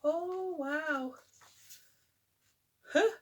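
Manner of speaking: plain reading voice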